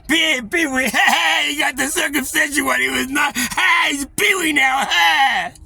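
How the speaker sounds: very high, loud jeering yell; mocking taunt shout. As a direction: shout the teasing nickname gleefully. Family taunting him loudly.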